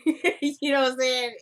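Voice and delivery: amused and loud, chuckling mid-phrase; laughing. Real, not acted. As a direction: laugh through the aside. Laughs at own cute-but-annoying remark.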